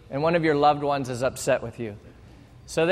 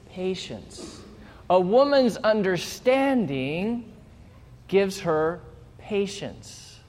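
slow reread, leaning on man's understanding; emphatic slow reread; 2 semitones higher, slower than usual. Shown as a demonstration. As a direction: stretch it out and press on understanding. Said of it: "let me say this verse again".

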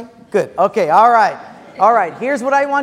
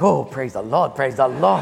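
lower, relieved sigh of praise; mock relief; 8 semitones lower than usual. Pastor mimics congregant relieved at wallet's return, low relieved praise. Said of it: "it's like".

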